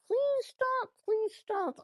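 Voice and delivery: high-pitched retold plea; imitated high plea to stop; mock pleading. Acted plea to cringy talk.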